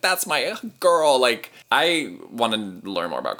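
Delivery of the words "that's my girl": slow, higher proud-mom voice; lightly voiced proud mom. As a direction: beam like a doting mother on the sidelines. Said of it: "the mom on the side being like".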